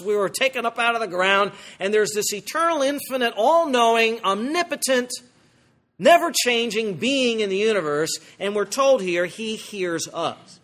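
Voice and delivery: usual speaking voice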